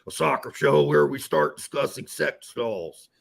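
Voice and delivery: slow, put-on voice, different timbre; imagined viewer voice. Reading Kong Snake's intro as he imagines him talking, slow and put-on. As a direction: drag it out in a silly character voice. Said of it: "Kong Snake sounds like you would talk like this".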